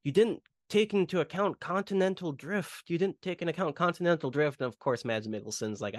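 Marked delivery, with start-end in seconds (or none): 0.0-4.6 higher-voiced imitation of a taunt; lightly voiced hero taunting the villain; smug Indy retort. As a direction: toss it off as a knowing jab. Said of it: "Indy, um, is like".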